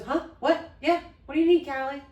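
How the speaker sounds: high, loud, jumpy responses; retold startled reply. Dad answering his screaming child, startled retold reply. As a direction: answer as if jolted by a yell.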